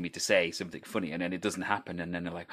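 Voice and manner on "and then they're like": ordinary talk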